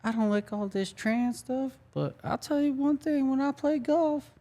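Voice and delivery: very high-pitched, slightly soft comic voice; Cat Williams impression. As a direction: do a squeaky, exaggerated stand-up comedian impression. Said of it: "I'll do the Cat Williams".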